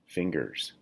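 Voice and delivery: ordinary talk, nothing marked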